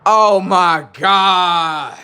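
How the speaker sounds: high, loud, long-drawn exclamation; mock horror. Comic reacting to the dripping hairline, drawn-out mock horror. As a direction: wail it slowly in comic disbelief.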